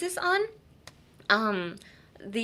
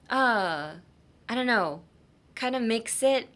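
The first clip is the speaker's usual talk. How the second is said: normal talking voice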